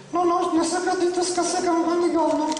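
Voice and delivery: high-pitched dummy voice; cheeky puppet. Acted. Puppet denying she knows the word, high puppet voice.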